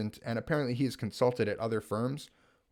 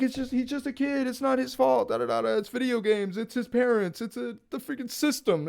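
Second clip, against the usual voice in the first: pleading coder; 12 semitones higher than usual. Retold or imitated. Coder voiced pleading for kid.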